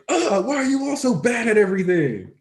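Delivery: high-pitched exasperated character voice; Bakugo. Retold or imitated. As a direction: groan it high, scornful of everyone. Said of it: "And he's like".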